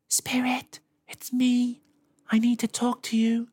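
high whispered call. A narrator's reading. Girl whispering to the hidden spirit, urgent whisper. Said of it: "She called out in a whisper".

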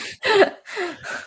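sheepish giggle trailing off; high embarrassed laugh. Real, not acted. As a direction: let out a short embarrassed laugh. Laughs after losing his thought.

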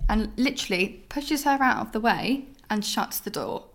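ordinary talk, nothing marked

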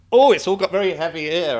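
high, loud jokey alarm; imitating A J Adudu. Friend defusing the tense moment, high loud jokey mock voice. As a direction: chirp it to break the tension. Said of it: "And A J Adudu went".

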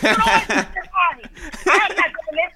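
imitating an annoyed mother, slowing down; hammed-up fed-up mom; mom flipping out. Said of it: "she flipped out on me".

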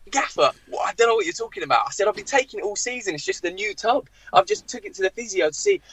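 high-pitched protest of innocence; baffled pleading. Retold or imitated. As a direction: act out pleading with an angry boss. Player pleading innocence to raging manager.

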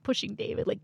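ordinary talk

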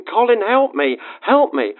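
mock shout of panic, not full force; shouting for help. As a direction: imitate his desperate call for help. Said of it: "and was shouting".

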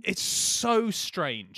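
very slow, stretched disbelief; drawn out. Real, not acted. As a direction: stretch every word out.

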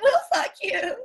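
high, loud mock-smitten girl; imitated eager girl. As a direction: blurt it like an overexcited fan. Acts out an American girl's eager response.

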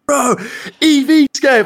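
imitating a fan, very high and loud; excited fan. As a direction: call out like a star-struck fan. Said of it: "and he's like".

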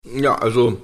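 talking while chewing; words round a bite of food; mouth full. Real, not acted. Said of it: "Hast du jetzt wirklich gegessen?". (mit vollem Mund)

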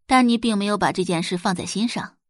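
ordinary delivery

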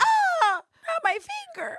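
high, loud squealing panic; hammed-up terrified yelp; mock panic. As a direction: squeal it like a man about to lose a finger. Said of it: "and he's like".

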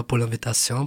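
ordinary talk, nothing marked

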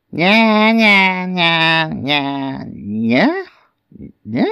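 put-on high baby whine, breaking into short squawks; mock baby wail. A narrator's reading. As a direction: imitate a wailing baby petering out. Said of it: "the baby was going".

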